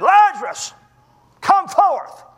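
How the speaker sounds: high, loud call to raise the dead; commanding shout. Acted. Jesus calls dead Lazarus from the tomb, commanding shout. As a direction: cry it out slowly, a thundering command.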